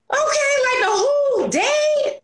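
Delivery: high, slow mock whimper, half-shouted; pity party wail. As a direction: wail it like a self-pitying drama queen. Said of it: "I throw myself a pity party".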